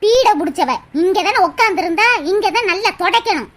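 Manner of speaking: low-pitched, resentful grumbling; spiteful grumbler. Acted. Woman cursing the unwelcome arrival, spiteful grumbling. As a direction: curse the visitor in a low voice.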